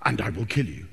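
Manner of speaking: calm threat to a kidnapper; Liam Neeson threat. Acted. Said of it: "You can imagine Liam Neeson saying it".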